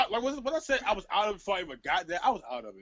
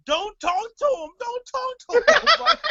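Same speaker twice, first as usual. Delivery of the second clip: imitating girl; 8 dB louder, slower than usual. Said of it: "we hear one girl like".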